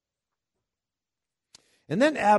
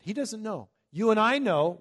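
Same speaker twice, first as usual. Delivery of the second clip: ordinary talk, nothing marked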